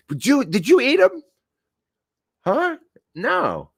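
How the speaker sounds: very high, squeaky mock interrogation and denial; hammed-up questioning. Boss asks driver about missing slices, very high comic exchange. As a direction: push it way up high, playing innocent.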